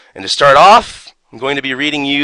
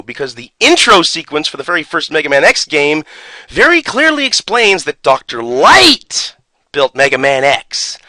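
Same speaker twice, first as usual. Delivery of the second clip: ordinary delivery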